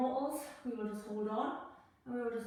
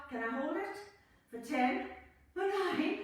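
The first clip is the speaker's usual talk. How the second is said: ordinary delivery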